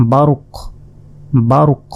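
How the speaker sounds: বারুক cut to a bouncing final q, no -un ending; stopping pronunciation. Shown as a demonstration. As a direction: end বারুক on an echoed q. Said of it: "এইভাবে উচ্চারণ করতে হবে".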